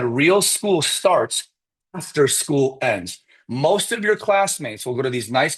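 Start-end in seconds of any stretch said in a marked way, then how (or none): 0.0-3.2 slow, weighty quote. Retold or imitated. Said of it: "He said".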